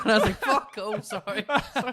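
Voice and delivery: high, loud laughing speech; laughing through the story. Real, not acted. Laughing while telling the story.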